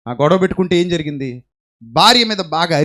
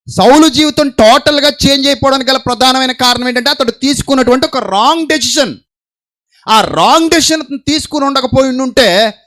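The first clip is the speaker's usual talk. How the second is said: high, loud pulpit voice; forceful preaching; 10 semitones higher, 7 dB louder than usual. Acted. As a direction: preach it to the whole church. (గట్టిగా ప్రసంగం)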